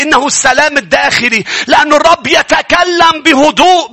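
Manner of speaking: high, loud, driving sermon delivery; full-force preaching. Acted. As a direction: preach it out to the congregation, building.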